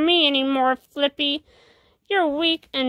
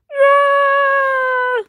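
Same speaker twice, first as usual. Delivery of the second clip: high, loud scream; falling scream; 9 semitones higher, 6 dB louder than usual. Acted. Petey screams as he falls from the sky. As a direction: yell as you drop.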